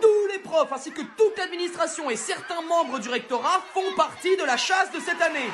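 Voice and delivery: high, laughing, slowed reveal; gleeful reveal. Acted. Headmaster revealing teachers join the hunt, laughing. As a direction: savour the big surprise. Climactic announcement.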